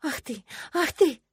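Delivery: slow, high breathless splutter. A narrator's reading. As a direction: choke on your outrage. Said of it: "задохнулась от возмущения". (задыхаясь от возмущения)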